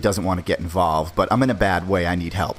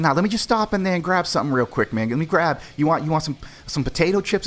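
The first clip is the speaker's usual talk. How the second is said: nervous higher voice; 7 semitones higher than usual. Retold or imitated. Narrator's own scared past self.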